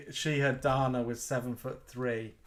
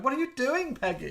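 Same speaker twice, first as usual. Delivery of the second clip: much higher-pitched playful judge voice; mock-concerned TV mentor, high-voiced; Tim Gunn; 10 semitones higher than usual. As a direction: pretend to question a contestant, pitched right up. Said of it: "you know, Tim Gunn in Project Runway".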